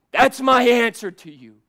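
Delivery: loud, high, building preacher's voice; climbing sermon crescendo; preaching at full force. Acted.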